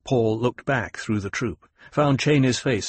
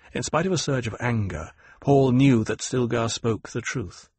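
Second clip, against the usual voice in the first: usual speaking voice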